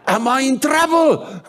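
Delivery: high, drawn-out mock scream. Startled man on the street answers the preacher, screaming. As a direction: yell it loud and far up in pitch. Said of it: "he was screaming it out".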